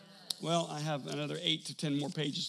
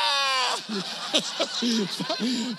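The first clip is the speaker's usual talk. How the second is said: loud laughter; 5 semitones higher, 6 dB louder than usual. Real, not acted. Retelling wife's reaction.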